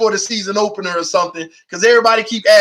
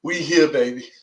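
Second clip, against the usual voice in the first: gracious welcome; 8 semitones lower, 6 dB quieter than usual. Pretend. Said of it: "I'ma put my arms out and hug 'em and say".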